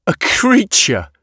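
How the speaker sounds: high, drawn-out scoff; derisive snort. A narrator's reading. Yatena mocks the witness's account, snorting in derision. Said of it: "Yatena snorted".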